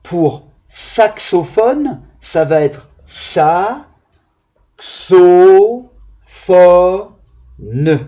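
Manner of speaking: slow, "xo" and "fo" hit loud; syllable by syllable. Shown as a demonstration. Teacher to pupils, counting syllables of saxophone aloud. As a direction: chop saxophone into sa, xo, fo, ne. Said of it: "Quatre syllabes".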